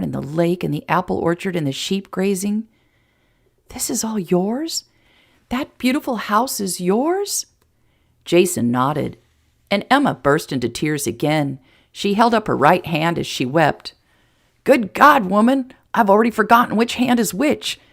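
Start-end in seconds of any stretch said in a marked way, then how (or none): 3.7-7.5 slow, high, wondering questions; amazed. A narrator's reading. Emma learning the ranch is Jason's, amazed. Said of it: "Emma's eyes went wide". 14.6-17.8 louder, higher protest; comic exasperation. Acted. Jason facing Emma's raised hand, comically exasperated.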